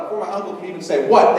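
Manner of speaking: ordinary delivery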